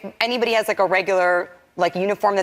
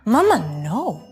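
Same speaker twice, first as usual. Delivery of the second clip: mock toddler objection; imitating toddler son; 6 semitones higher, slower than usual. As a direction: plead like a small child stopping mom singing. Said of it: "and he goes".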